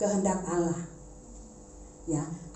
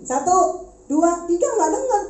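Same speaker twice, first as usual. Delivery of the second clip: stern countdown; 8 semitones higher, 5 dB louder than usual. Retold or imitated. Counting down to a child.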